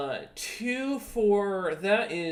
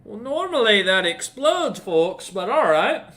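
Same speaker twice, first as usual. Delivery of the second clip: loud, raised game-master giant; higher, louder voiced giant; giant's voice; 5 semitones higher, 7 dB louder than usual. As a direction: play a giant taking a setback in stride. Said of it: "the giant looks about and goes".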